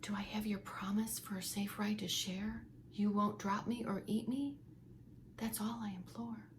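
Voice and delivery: hushed frightened plea; nearly a whisper. Acted. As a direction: breathe it fearfully to the fox. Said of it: "sang in nearly a whisper".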